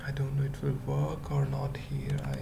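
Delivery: ordinary talk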